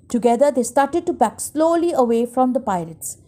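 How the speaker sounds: usual speaking voice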